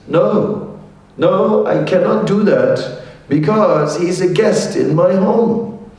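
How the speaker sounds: a bear defending his guest; noble bear refusing. Acted. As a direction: say no kindly but firmly.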